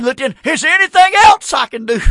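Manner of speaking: a priest jumping back in alarm; a lightly voiced frightened priest; startled priest.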